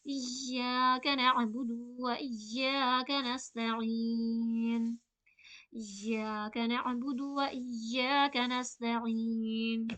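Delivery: slow recitation, इय्याका with the vowels drawn equally. Shown as a demonstration. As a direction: chant it evenly and slowly. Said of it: "दोनों को बराबर खींचना है".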